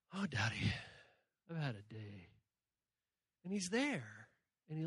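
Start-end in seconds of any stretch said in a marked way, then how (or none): 0.1-2.3 weary sigh to Daddy. Retold or imitated. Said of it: "crawl up in God's lap and say".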